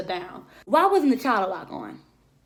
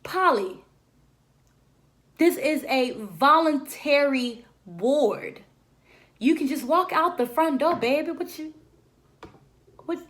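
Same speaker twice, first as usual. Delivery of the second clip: incredulous nurse; 4 semitones higher than usual. Retold or imitated. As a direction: spell out the obvious to a fool. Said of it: "And then the nurse comes out and looks down and just like".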